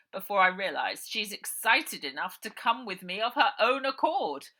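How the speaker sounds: loud, mocking villain voice; loud, sneering villain; gloating villain uncle. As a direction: gloat over the trapped girl. Villain gloats over trapped Ida.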